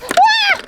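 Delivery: high thrilled scream as it drops; playful scream dropping down; ride scream. Pretend. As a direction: shriek on the fall. Drop tower scream.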